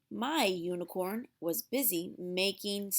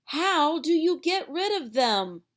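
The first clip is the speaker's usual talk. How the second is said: exasperated; 6 semitones higher, 7 dB louder than usual. A narrator's reading. Desperate question.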